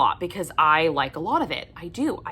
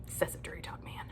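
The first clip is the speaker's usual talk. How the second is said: whisper; 16 dB quieter, faster than usual. Real, not acted. Whispered conclusion.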